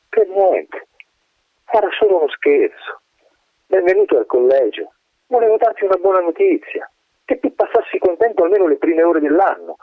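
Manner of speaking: the playful wind, pitched high above the narrator; a light, airy wind voice teasing the colonel; high wind spirit voice. Acted. Wind Matteo teasing the dying colonel, high voice.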